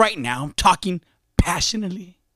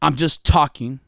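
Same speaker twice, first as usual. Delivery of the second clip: normal talking voice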